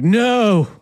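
sharp high gasp of disbelief; a sudden high alarmed no; shocked no. Retold or imitated. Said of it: "I was like".

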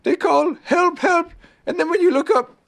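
high-pitched bear explaining; knowing Pooh Bear. A narrator's reading. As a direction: explain it simply in a high character voice. Narrator voices Pooh explaining.

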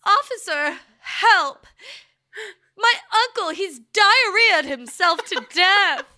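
high, loud, wailing cry for help; frantic old-timey plea. Acted. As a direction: beg the officer in a panic. Acting out a frantic call.

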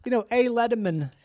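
laughing mock New York accent. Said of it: "the most New York person I ever met".

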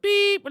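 high, loud mouthed beep; imitated voicemail beep. Comic playing the voicemail tone, high loud beep. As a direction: mimic an answering machine tone.

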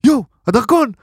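high, panicked yelp about the passport; hammed-up passport panic; panicked shriek. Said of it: "פתאום יוגב עושה".

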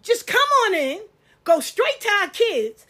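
loud, high, outraged ranting; rising indignant outburst, real heat. Real, not acted. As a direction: let the outrage build at the intruder. Indignant rant at intruder.

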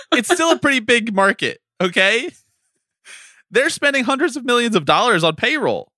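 mad rant. Real, not acted. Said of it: "I'm clearly so mad".

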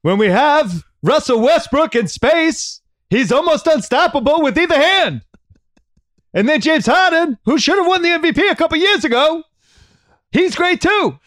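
put-on high, loud, strained old-politician voice; Bernie Sanders impression. Cohost does his Bernie as Rockets coach, high and loud. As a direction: lecture about basketball as Bernie. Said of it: "my impersonation of Bernie as the coach".

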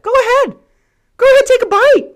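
sing-song falsetto with rage under the sugar; Mickey Mouse voice. Retold or imitated. To the customer, a high cartoon-mouse voice hiding anger. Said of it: "full Mickey Mouse voice trying to hold back the anger".

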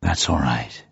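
low, gentle reassurance; comforting. A narrator's reading. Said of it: "said Bond comfortingly".

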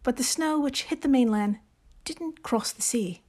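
hammed-up British accent, measured reading; amateur British accent with deliberate pauses; Jane Austen voice. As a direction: read it like a genteel British lady novelist. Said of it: "in your best Jane Austen voice".

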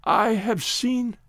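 slow, broken, tearful words; sobbing. A narrator's reading. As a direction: choke the words out through sobs. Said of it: "Tessa sobbed and tried to speak".

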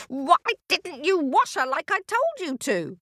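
high-pitched, indignant telling-off; scolding witch. Acted. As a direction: demand an answer crossly.

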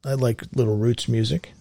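normal talking voice

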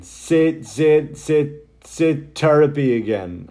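loud, high, chanting sit over and over; pestering fan. Retold or imitated. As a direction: chant it like you're goading him on. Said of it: "sick of people going".

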